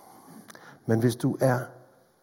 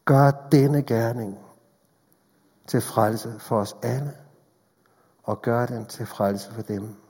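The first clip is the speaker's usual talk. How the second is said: spoken prayer; 2 semitones lower than usual. Acted. Leads closing prayer. (stille, langsom bøn)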